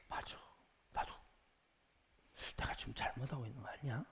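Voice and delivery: very soft, low inner voice; whispering doubt. Acted. A listener starting to doubt themselves, hushed whispering. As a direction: murmur it to yourself as doubt creeps in. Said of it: "이렇게 속삭여요".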